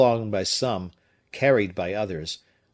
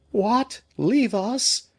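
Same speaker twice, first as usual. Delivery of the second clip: high, anguished protest; grief-stricken cry; 13 semitones higher, 4 dB louder, much slower than usual. A narrator's reading. The women cry to Fouquet in wild grief. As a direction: plead against being left behind. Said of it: "cried both the women, wild with grief".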